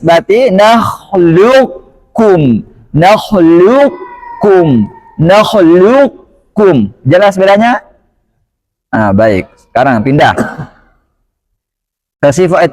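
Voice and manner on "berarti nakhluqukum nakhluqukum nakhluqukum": nakhluqukum slow, the qaf's heaviness kept as it merges into kaf; idgham nakis reading. Shown as a demonstration. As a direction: say nakhluqukum very slowly, keeping a trace of qaf. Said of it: "Kalau dibaca idgham nakis".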